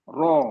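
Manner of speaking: رو with rounded o sound, heavy. Shown as a demonstration. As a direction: say رو rounding to an o at the end. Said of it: "او کی آواز آ رہی".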